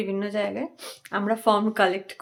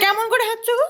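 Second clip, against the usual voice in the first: playful coaxing; 10 semitones higher than usual. Real, not acted. Parent asking a child to show their sneeze, playfully coaxing. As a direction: coax a little one in a high voice.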